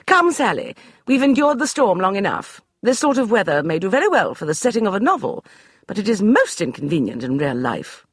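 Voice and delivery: high, brisk dismissal; imperious lady; commanding lady. Acted. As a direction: brush him aside like a general. Beatrice voiced.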